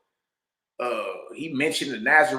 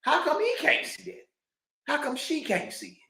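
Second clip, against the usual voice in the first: high, worked-up mock disbelief; incredulous; 10 semitones higher, 6 dB louder than usual. Retelling his own reaction, high incredulous voice. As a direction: act out amazed bafflement. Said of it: "I'm like".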